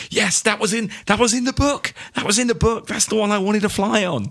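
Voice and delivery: put-on high, eager childlike gush; mock childish excitement; excited kid voice. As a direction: gush like a kid seeing his dream ship. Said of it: "I'm always like".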